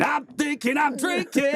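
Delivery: high, slow, shouted boast; triumphant shout. Retold or imitated. Singer gloating after decking the guy, shouting high. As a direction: crow it out after the punch. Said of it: "I was like".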